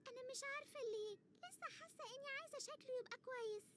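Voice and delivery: put-on high, tearful child voice; worried girl near tears; teary little girl. (صوت بنت صغيرة باكية)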